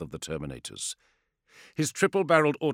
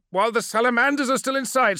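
alarmed objection; 6 dB louder than usual. Acted. Chrysaor objects.

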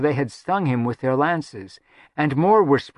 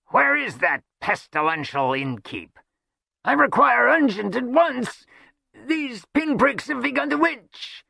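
pompous demand rising to a high whine; indignant rogue; 12 semitones higher than usual. Acted. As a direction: play an itching, imperious rogue. Voices Chimwazzle, a pompous rogue.

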